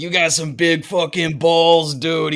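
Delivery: impressed East Coast tough guy; JoJo impression. The guard praising their nerve, slow East Coast accent. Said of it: "I love your JoJo impression".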